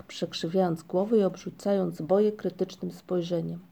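plain reading voice

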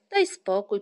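loud, brisk cut-in; emphatic dismissal; interrupting. A narrator's reading. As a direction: cut the other off firmly. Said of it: "przerwał mu Muminek".

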